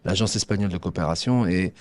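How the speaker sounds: usual speaking voice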